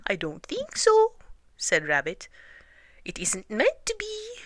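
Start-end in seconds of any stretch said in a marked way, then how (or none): 0.1-1.2 very high put-on voice; disguised Rabbit voice. A narrator's reading. Rabbit denies himself from the hole, high disguised voice. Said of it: "in a different sort of voice". 3.0-4.4 very high put-on voice; disguised Rabbit voice. A narrator's reading. Rabbit pretends to be someone else, high disguised voice. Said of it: "in a different sort of voice".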